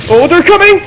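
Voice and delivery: high, loud cry of warning; sudden burst of panic; alarmed. Real, not acted. Zombies arrive.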